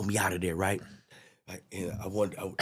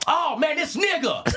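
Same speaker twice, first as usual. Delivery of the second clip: much higher, louder mock shout; 4 dB louder, slower than usual. Annoyed passenger mocking the drunk, high shout. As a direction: squeal it out like a scornful passenger.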